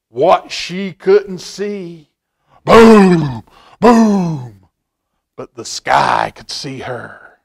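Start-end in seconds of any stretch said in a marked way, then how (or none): 2.6-4.7 loud booming thunder words; big dramatic thunder boom. A narrator's reading. Thunder sound in story.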